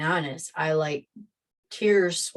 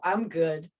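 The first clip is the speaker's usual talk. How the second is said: normal talking voice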